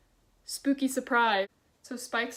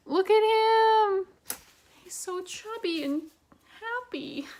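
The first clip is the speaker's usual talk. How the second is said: cutesy coo; 9 semitones higher, 5 dB louder, slower than usual. Real, not acted. Cooing at a cute ghost picture, high and loud.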